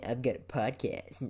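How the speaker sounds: lightly put-on smug bragging voice; bragging asshole. Mimicking how he doesn't want to sound, mock bragging. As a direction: show off like an asshole. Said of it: "I'm not always going around".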